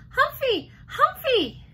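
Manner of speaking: put-on high, loud toddler squeal; toddler shouting. A narrator's reading. Little boy rushing to the table, shouting excitedly. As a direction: shout it like an excited tiny child.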